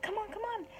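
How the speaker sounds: high-pitched, hushed urging; urging little mouse. A narrator's reading. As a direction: coax them along in a small high voice. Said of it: "he's with the cute little mouse".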